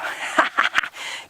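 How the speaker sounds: short, unvoiced chuckle; laugh. Real, not acted. Guide as the crocodile ducks under again, laugh. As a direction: laugh it off in quick breathy bursts.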